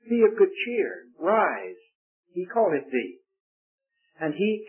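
measured, lifted Bible reading voice; scripture reading. Acted. Preacher reading Mark to the congregation, solemn and measured. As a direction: read the Gospel aloud to the congregation, deliberately. Reads Gospel passage aloud.

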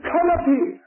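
high, ringing call; shouted summons. Acted. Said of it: "And He shouts".

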